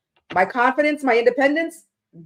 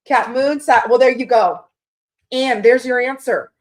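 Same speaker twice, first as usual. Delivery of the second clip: triumphant; 4 semitones higher, 6 dB louder than usual. Real, not acted. Excited reading viewer's chart.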